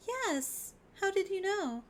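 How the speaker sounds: put-on extremely high, squeaky girl voice; high cutesy character. Chum surprised by friend's guess, squeaky high voice. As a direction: hold a tiny sky-high voice. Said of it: "It's so high".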